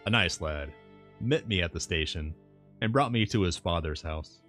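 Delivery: plain reading voice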